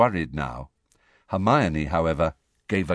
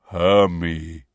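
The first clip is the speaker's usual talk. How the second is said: low, slow giant rumble; huge creature's low voice; deep rumbling voice; 6 semitones lower, 3 dB louder than usual. A narrator's reading. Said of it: "said in a deep, rumbling voice".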